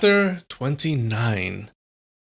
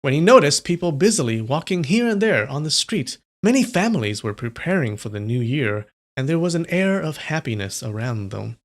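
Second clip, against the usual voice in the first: louder, steady read-aloud narration; story narration; 4 semitones higher, 5 dB louder than usual. A narrator's reading. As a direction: read the chapter out to chat like a storyteller. Reads novel chapter aloud.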